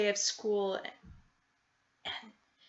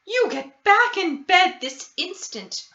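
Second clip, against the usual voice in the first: high, louder stern motherly command; ordering; 10 semitones higher, 7 dB louder than usual. Acted. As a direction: order the child straight back to bed. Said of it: "her mother ordered".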